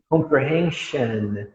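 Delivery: slow "comprehension" ending in "shun"; correct "an" ending. Shown as a demonstration. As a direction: reduce the last vowel of "comprehension". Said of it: "Olha a diferença". ("comprehension" devagar, com som de "an" no final)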